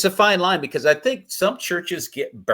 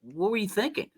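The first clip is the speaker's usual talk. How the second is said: quoting himself; 8 semitones higher, 4 dB quieter than usual. Retold or imitated. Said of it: "I went to him later and I said".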